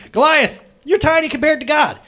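loud, high taunt at a giant; defiant shout. Retold or imitated. David taunting Goliath, a high defiant shout. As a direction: yell it up at Goliath, fearless. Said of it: "who in strength goes".

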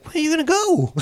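high-pitched baffled question; mock incredulous. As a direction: act out bewilderment, voice jumping way up. Retold baffled question to upset friend.

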